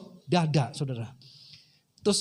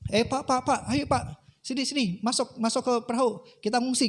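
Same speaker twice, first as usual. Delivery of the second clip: urgent calling; 6 semitones higher than usual. Retold or imitated. Rescuers urging man into boat.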